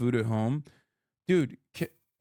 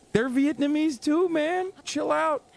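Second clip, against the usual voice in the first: much higher, sing-song 'chill, man', teasing a worked-up chatter; mock soothing; 9 semitones higher than usual. Streamer mockingly calms a chatter mad about Vietnamese food, much higher teasing voice. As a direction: pat an angry fan on the head, mockingly.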